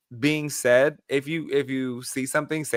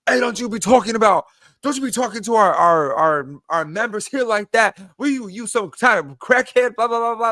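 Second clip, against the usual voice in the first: imitated big New York cleaning guy, higher and louder; scolding New Yorker; 7 semitones higher, 5 dB louder than usual. Cleaning guy scolding the rude gym man, imitated loud rebuke. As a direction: tear into him like a burly local worker.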